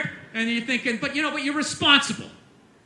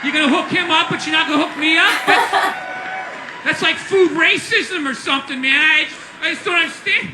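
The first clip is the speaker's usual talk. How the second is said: pothead. Acted. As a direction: complain like an aggrieved pothead. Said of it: "All the potheads showed up going".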